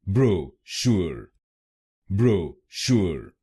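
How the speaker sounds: ब्रोशूर stretched very slowly, bro-SHOOR; slow American pronunciation. Shown as a demonstration. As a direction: draw out ब्रोशूर syllable by syllable. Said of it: "American English में इसको बोलेंगे". (ब्रोशूर बहुत धीरे-धीरे)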